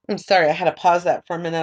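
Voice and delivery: ordinary talk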